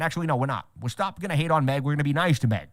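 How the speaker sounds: ordinary delivery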